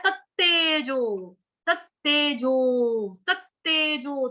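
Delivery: तत्तेजो said slowly with the doubled त pressed hard; slow pronunciation drill. Shown as a demonstration. Teacher contrasting the two तेजो forms for the class, slow and stressed. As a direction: stretch तत्तेजो, leaning on the त्त. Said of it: "उच्चारण अलग-अलग होगा इनका".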